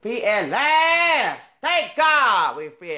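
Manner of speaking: slow, high, loud preacher-style quote; lightly voiced sermon rising on free at last; preaching like Martin Luther King. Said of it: "as what Martin Luther King said".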